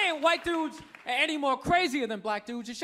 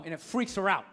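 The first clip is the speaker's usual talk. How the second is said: usual speaking voice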